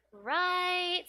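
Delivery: "Right?" drawn out high and loud; seeking agreement. Shown as a demonstration. Tutor showing how English asks for agreement, "Right?" high and drawn out. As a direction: stretch "Right?" up high as a tag question. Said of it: "in English if you go".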